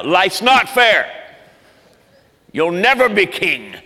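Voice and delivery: villain uncle gloating at the cub; Uncle Scar. Retold or imitated. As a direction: drawl the threat like a cartoon villain. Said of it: "that's Uncle Scar".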